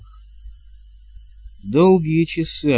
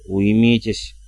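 low, restrained command; stern order; 3 semitones lower, slower than usual. A narrator's reading. Matriarch orders the fussing witches to stop, stern. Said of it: "велела матушка".

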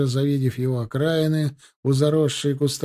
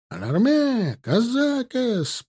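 high, drawn-out alarm cry; frantic cries; 10 semitones higher, slower than usual. A narrator's reading. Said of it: "раздались на ней истошные крики".

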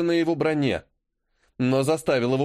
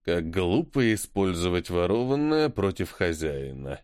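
contemptuous; 7 semitones lower than usual. Acted. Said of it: "презрительно заметила".

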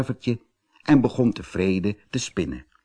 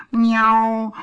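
long, high, pitiful mew; crying meow; 12 semitones higher, 4 dB louder than usual. A narrator's reading. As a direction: mew like an abandoned kitten. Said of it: "huilde hij".

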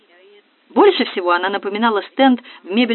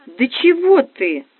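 laughing; 5 semitones higher, 4 dB louder, much slower than usual. Acted. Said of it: "засмеялась Настя".

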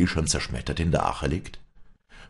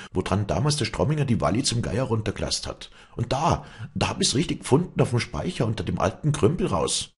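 higher, eager, hurried telling; excited report; 7 semitones higher, faster than usual. Acted. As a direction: rush out the news of the find. Klettermeier excitedly reports his find. (aufgeregt und hastig erzählend)

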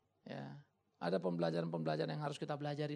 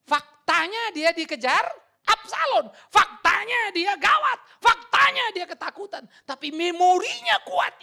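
much higher and louder, slow and hammering; slow shouted preaching, higher pitched; 14 semitones higher, 18 dB louder, slower than usual. Real, not acted. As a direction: thunder each fact from the pulpit.

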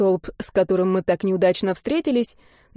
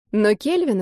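usual speaking voice

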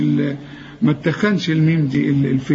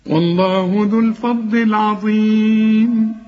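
slow, melodic tajweed chanting, higher and drawn out; measured, sung recitation of the verses; Quran recitation; 5 semitones higher, much slower than usual. Acted. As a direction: intone the verses like a reciter in the mosque.

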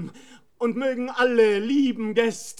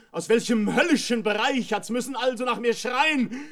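normal talking voice

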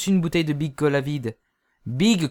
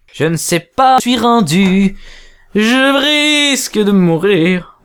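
dramatic dying cry; 4 semitones higher, 8 dB louder than usual. Acted. Character despairs loudly.

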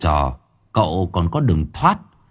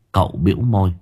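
usual speaking voice

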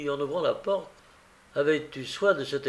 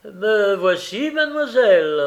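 calling back; 7 semitones higher, 8 dB louder than usual. A narrator's reading. Gilles answering mademoiselle upstairs, calling loudly. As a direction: answer smartly at full voice.

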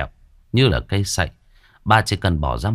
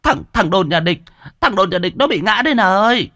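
crying out; 12 semitones higher than usual. Acted. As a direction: shout for help, frantic and fast. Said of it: "liền rú lên".

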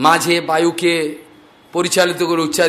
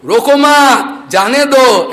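high, loud and slow; the master bellowing in Hindi from the wall; shouted order; 6 semitones higher, 7 dB louder, slower than usual. Retold or imitated. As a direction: yell "রোকো মা জানে দো" at the fleeing thief. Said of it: "এটা বলতে রো".

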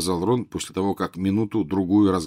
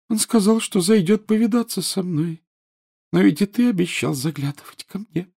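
high, reproachful schoolgirl ghost; whiny ghost girl; 12 semitones higher than usual. A narrator's reading. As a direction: sulk like a neglected ghost girl. Narrator voices Myrtle.

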